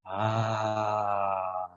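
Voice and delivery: long, voiced exhaling sigh. Shown as a demonstration. Meditation leader shows breathing out, long sigh. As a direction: breathe out on a drawn-out "ah". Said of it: "perhaps with a sigh".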